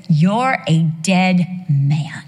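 slow, heavy, deliberate verdict; God's stern verdict. Acted. God warning the king, slow stern verdict. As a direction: lay it down slowly like judgment. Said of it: "he says".

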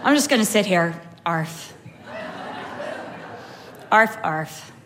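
low, deadpan mock dog voice; jaded Chihuahua. Chihuahua sick of running to the door, jaded. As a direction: voice a fed-up dog, barking half-heartedly. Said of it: "just so over it".

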